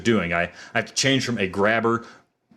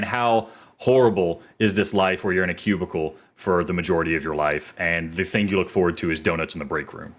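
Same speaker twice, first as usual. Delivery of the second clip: normal talking voice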